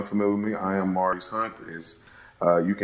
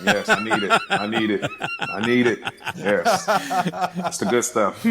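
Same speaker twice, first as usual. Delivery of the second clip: giggling through the words, pitch jumping; real laughter breaking up each line; laughing hard; 11 semitones higher than usual. Real, not acted. Laughing at his friend's silly rap impression.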